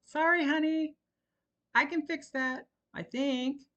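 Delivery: high, loud sheepish call; husband yells down after a crash. A narrator's reading. A husband yells a sheepish apology. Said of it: "Alden yelled".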